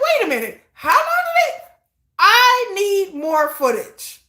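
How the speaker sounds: high, laughing, then slow stretched demand; squealing outrage. Retold or imitated. Fan watching the documentary again, laughing outraged squeal. Third viewing, demanding more footage.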